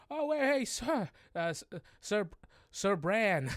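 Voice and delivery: high, stammering goblin plea; flustered goblin protest. Acted. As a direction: squeal for help as a grabbed goblin. Goblin grabbed by the scruff protests.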